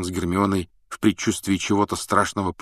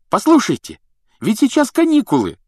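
high, loud cheerful exclamation; exclaiming; 8 dB louder than usual. Acted. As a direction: burst out brightly. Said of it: "воскликнул Рон".

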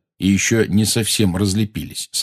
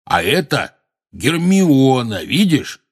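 higher, name drawn out slowly; coaxing introduction; 5 semitones higher, 3 dB louder, slower than usual. A narrator's reading. Hagrid presenting Hermione to the giant, coaxing. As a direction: present her as to a slow child.

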